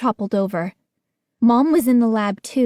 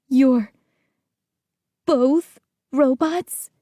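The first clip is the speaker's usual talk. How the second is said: stammering; 3 semitones higher, slower than usual. A narrator's reading. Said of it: "Gates stammered".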